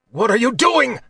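high, strained cry of shock; choked out. A narrator's reading. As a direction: choke out a stunned, pained question. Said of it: "Kylar choked out".

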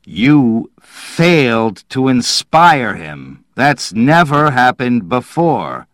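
disbelief. A narrator's reading. Manville confronting Slade over his failure, disbelieving. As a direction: weigh out the words in shocked disbelief. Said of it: "Manville stared down at him in disbelief".

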